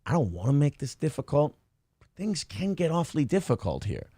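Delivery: soft, even mobster warning; a hint of a quiet mobster; quiet mobster threat. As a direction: threaten calmly under the breath. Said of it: "how a respected guy talks".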